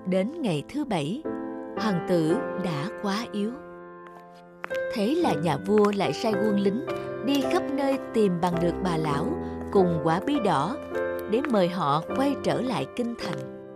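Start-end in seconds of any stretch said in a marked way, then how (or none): none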